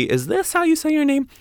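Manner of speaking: imitating a sheepish ask, high; tentative question. Asking an author about their name, tentative high question. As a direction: voice lifting high at the end.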